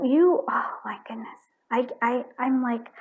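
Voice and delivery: ordinary talk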